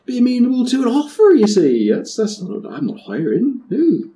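high, loud flustered West Country pirate protest; flustered denial. Acted. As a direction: backpedal fast, voice jumping high in panic. Gravesby flustered, denying he's hiring.

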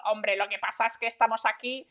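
switched into a Spanish speaking voice. Acted. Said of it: "t'as vu comment j'ai changé mon, ma voix".

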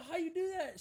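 high, astonished ask; retold high disbelief; amazed question. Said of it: "we told her".

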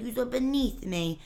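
slow put-on Staten Island accent; mock mixed accent. Imitating the guy's shifting accent, slow and sneering. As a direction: sneer it slowly. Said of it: "his accents kept changing".

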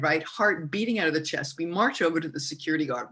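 ordinary talk, nothing marked

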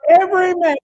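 sudden loud high call of the words. Real, not acted. Preacher booming a scripture line to the church, loud. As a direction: fling it out to the congregation.

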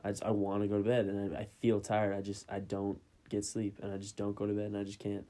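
ordinary delivery

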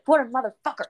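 retold worked-up swearing; ranting friend. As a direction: imitate an indignant friend cursing. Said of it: "she was going on a rant".

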